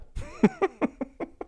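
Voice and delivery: soft, high-pitched chuckle; quiet breathy laughter; amused chuckle. Real, not acted. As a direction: chuckle helplessly at the line just read. Laughs at his own quote.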